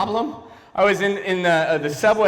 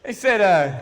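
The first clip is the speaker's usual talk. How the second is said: ordinary talk, nothing marked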